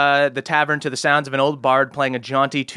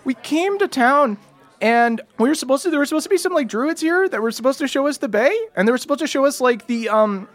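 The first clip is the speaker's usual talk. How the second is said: put-on higher, stammering nervous young voice; nervous halfling boy; 11 semitones higher than usual. Halfling scoutmaster pleading with a hero, high and jittery. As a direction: blurt it out like a scared scout leader.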